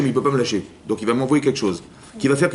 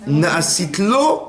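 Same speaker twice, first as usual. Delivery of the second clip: slow drawn-out word; 5 semitones higher, 4 dB louder, much slower than usual. Shown as a demonstration. Rabbi quoting the Talmud to his listeners, slow and drawn out. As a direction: draw the word out, syllable by syllable, higher.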